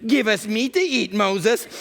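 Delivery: mock the people's wail mid-rant. Moses mimics people demanding meat, mock wailing. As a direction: imitate a complaining crowd, high-pitched. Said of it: "they keep wailing".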